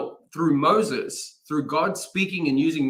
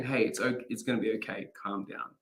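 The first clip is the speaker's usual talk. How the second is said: soft, fading, soothing calm down; gentle reassurance; 4 semitones lower, 9 dB quieter than usual. Retold or imitated. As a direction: comfort a friend quietly. Contrast with kind version.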